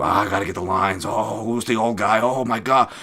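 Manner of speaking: hammed-up gambling fan moaning, stretched out; mock FOMO panic. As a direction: whine theatrically like a bettor afraid of missing out. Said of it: "going off on M M A gambling Twitter and their fomo".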